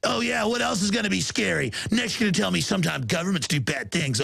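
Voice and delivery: sarcastic skeptic. Retold or imitated. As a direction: ham up the disbelieving sneer. Mocking a scoffing member of the public.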